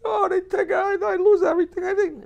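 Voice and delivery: put-on high, loud, pleading Italian accent; Italian farmer accent. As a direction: beg the bandits in a hammy Italian accent. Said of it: "The farmer's all upset".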